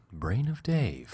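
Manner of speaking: slow, soft eerie voice; drawn-out creepy title; spooky voice. Pretend. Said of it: "In that voice".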